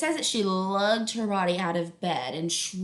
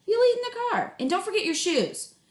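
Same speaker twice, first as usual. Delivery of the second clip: higher, louder mom calling; a busy mom calling up; brisk mom voice, pitched up; mom's voice; 12 semitones higher, 3 dB louder than usual. Acted.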